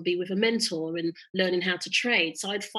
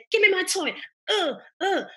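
put-on squabbling child; 11 semitones higher, 5 dB louder than usual. Child's squabble voiced in story.

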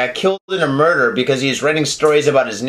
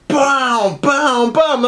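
high, punchy booms climbing in pitch; imitated banging; 8 semitones higher, much slower than usual. Sudden sledgehammer at the door, imitated banging. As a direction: voice the blows as mounting booms.

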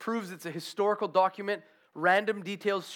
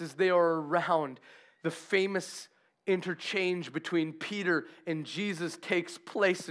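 normal talking voice

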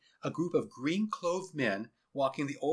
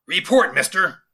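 loud, higher, commanding officer; barked command; 5 semitones higher, 13 dB louder than usual. Acted. General demanding a report from his lieutenant, barking. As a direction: fire it off as an order.

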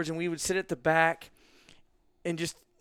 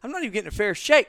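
high, loud and fast frustrated protest; indignant complaint; 7 semitones higher, 10 dB louder, faster than usual. Retold or imitated. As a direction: blurt it out in outrage, quickly. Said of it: "I'm like".

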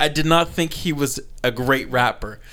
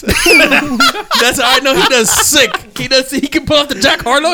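laughing; 9 semitones higher, 4 dB louder, slower than usual. Real, not acted. Laughing hard.

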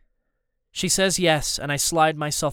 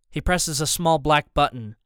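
ordinary delivery

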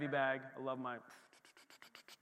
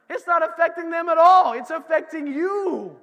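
preaching shout; 8 semitones higher, 13 dB louder than usual. Real, not acted. Preacher to congregation, loud emphatic declaration. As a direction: hammer the point home to the crowd. Preacher driving the point home.

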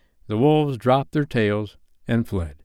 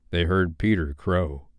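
ordinary delivery

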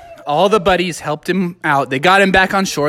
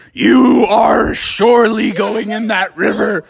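lightly hammed-up stern command; stern insistence. Bubby telling reluctant Smee he must jump, mildly booming order. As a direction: insist with drawn-out emphasis.

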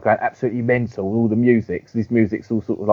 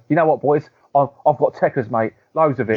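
British wrestler impression; 4 semitones higher than usual. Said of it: "he goes".

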